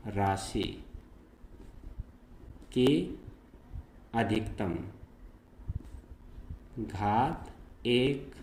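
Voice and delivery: slow, word by word, long pauses between; spaced-out dictation pace for note-taking. Shown as a demonstration. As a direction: dictate each word so students can write it down. Dictating the definition of linear polynomial.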